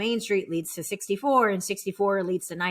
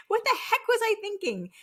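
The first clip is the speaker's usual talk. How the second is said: laughing; 9 semitones higher, faster than usual. Real, not acted. Laugh heard on line.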